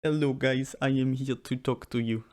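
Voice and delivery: put-on Serbian accent greeting; mock Serbian accent. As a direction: greet them as a tall Serbian guy. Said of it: "put on a Serbian accent".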